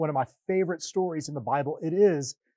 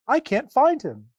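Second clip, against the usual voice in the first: urgent; 9 semitones higher, 7 dB louder than usual. Acted. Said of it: "Mary said urgently".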